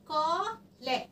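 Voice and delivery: "قا" held long, then "لا" cut short; long then snatched. Shown as a demonstration. Teacher dictating madd and short vowel, long then short. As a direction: stretch "قا", pause, snatch the short "لا". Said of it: "أخطفهم بسرعة".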